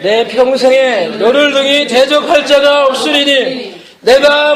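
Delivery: slow, measured Bible reading; solemn scripture reading to the congregation. Acted. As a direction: read it out to the church, unhurried. Reads Joshua aloud to congregation.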